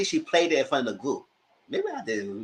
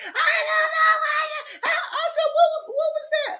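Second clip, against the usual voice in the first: shrieking high, laughing mockery of her yelling; mock screech; slower than usual. Vlogger mocking a reality star yelling at Crystal, shrill. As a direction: squeal it like a hysterical housewife.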